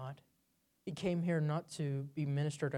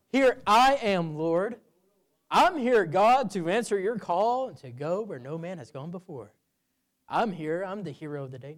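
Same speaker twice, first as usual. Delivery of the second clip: boastful; 2 semitones higher, 9 dB louder than usual. Shown as a demonstration. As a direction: proclaim yourself the hero of the day. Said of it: "the emphasis of that sentence is not".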